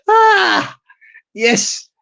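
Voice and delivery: normal talking voice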